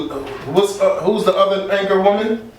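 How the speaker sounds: usual speaking voice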